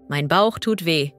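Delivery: usual speaking voice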